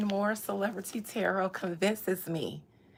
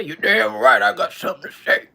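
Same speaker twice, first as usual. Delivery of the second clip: loud grumpy husband shouting; ornery husband shouting back; grumpy old man; 10 dB louder than usual. Pretend.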